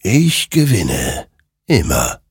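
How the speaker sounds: slow, weighty creature voice; ominous rider. Acted. Rider claiming victory to Hektor, slow and ominous. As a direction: space the words out with finality.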